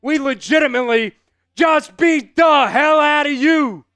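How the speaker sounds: gloating shout. Real, not acted. Streamer gloating at Cougars fans, loud shout. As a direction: rub it in their face.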